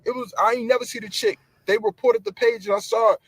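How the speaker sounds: usual speaking voice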